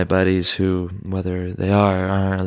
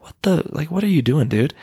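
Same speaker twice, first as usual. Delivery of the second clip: baffled outburst; 7 semitones higher than usual. Retold or imitated. Buddy reacting, baffled.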